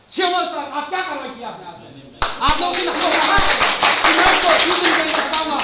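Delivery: rising into a loud, angry outburst at the official; angry confrontation. Real, not acted. Protester confronts CMO at meeting. (गुस्से में ऊँची आवाज़ में अधिकारी से सवाल)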